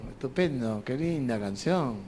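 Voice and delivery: ordinary talk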